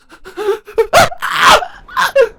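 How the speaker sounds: loud high agonized gasp; sharp pained gasp. Acted. Sister seized by sudden pain, a loud high gasp.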